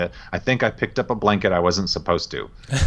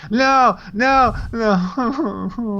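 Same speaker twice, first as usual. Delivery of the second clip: mimicking a little girl; 13 semitones higher, much slower than usual. Daughter refusing hug, retold.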